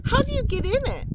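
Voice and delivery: high, quick and curious; a small mouse asking, fast and bright; eager little mouse. Acted. Young mouse asks eagerly.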